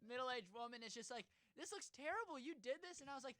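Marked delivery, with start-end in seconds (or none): 1.6-3.0 high-pitched indignant complaint; mock customer complaint. Customer complains to young barber about a haircut, mock indignant. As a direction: complain in a raised, much higher voice.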